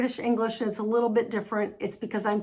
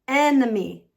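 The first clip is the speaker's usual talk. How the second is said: normal talking voice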